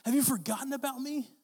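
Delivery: high-pitched imitated plea; crying out to God. Preacher voicing David's honest complaint to God, crying out. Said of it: "he cries out to him".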